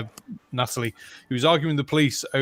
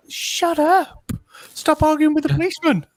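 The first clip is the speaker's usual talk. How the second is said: exasperated yell; 13 semitones higher than usual. Retold or imitated. Viewer fed up with the arguing man, high mock shout. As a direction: yell at him to quit it. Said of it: "you just feel like".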